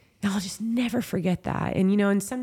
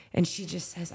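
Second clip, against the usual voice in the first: normal talking voice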